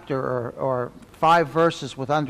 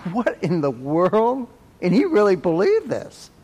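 real laugh of disbelief at the story; 4 semitones higher, 3 dB louder than usual. Real, not acted. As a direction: laugh at how absurd it is.

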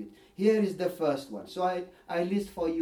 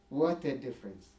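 repeated softly, lower and quieter; 5 semitones lower, 5 dB quieter than usual. Real, not acted. Preacher marvelling to his congregation, softly. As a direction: let the awe sink in, hushed.

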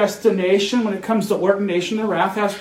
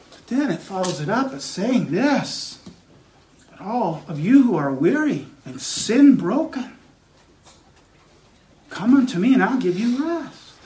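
gentle preaching. Acted. Preacher offering rest to the weary, gentle preaching. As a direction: drop the force and coax the weary. Gentle invitation after the charges.

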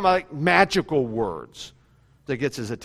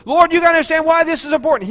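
higher and louder pleading; mock pleading prayer; 10 semitones higher, 5 dB louder, faster than usual. Preacher imitating a believer begging God, raised voice. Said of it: "we don't have to really say".

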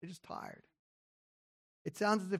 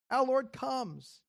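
slow, lifted proclamation; 6 semitones higher, much slower than usual. Shown as a demonstration. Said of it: "So, Maranatha".

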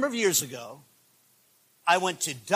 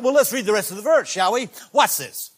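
ordinary talk

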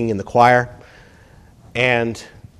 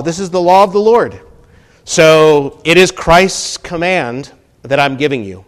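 loud, raised, emphatic teaching from the pulpit; forceful preaching; 6 semitones higher, 6 dB louder than usual. Acted. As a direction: drive the point home loudly.

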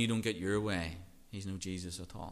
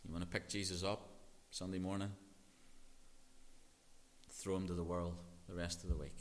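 ordinary talk, nothing marked

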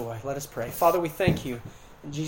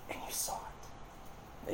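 slow whisper; 21 dB quieter, much slower than usual. Real, not acted.

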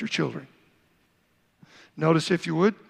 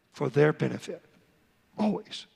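usual speaking voice

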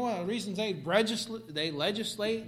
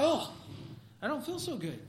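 whiny; 7 semitones higher than usual. Retold or imitated. Mocking sick person.

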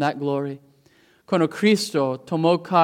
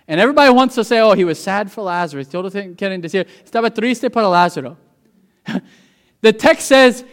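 high, loud preacher's cry; full-force preaching; 4 semitones higher, 6 dB louder than usual. Acted. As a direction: preach it out to the whole church with fire. Preaching in two languages.

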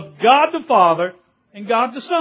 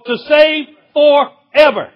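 loud, high and slow on "save forever"; emphatic forever; 5 semitones higher, 6 dB louder than usual. Real, not acted. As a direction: punch "save", then split "for" and "ever". Correcting 'a long time' to forever.

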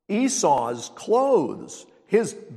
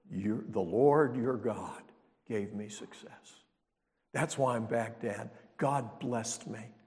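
softer, lower, slow sanctimonious reply; pious liar; 5 semitones lower, 6 dB quieter than usual. Retold or imitated. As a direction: fake humble piety. Jacob lying piously to father.

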